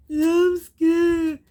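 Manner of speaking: loud, high sarcastic whine; mock scared wail. Viewer scoffing at crying bride, loud mocking whine. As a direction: ham up her whimper to mock it. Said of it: "She just bawled and bawled".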